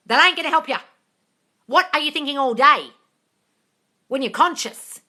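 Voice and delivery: exasperated loud lecture; loud scolding. Real, not acted. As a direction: scold them hard. Scolding the viewer.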